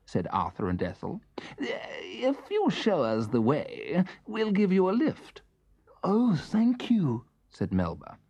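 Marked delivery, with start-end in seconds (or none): 1.6-5.4 sly hunters. Acted. Wicked hunters sweet-talking. 6.0-7.2 grateful little elephant. Acted. Melba grateful.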